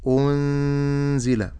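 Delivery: أنزل slow with a light lam and hidden nun; correct recitation. Shown as a demonstration. Teacher giving the final correct form. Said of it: "فأقول".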